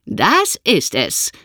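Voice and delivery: put-on slow, lightly proud old lady; mildly proud. A narrator's reading. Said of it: "sagt sie stolz".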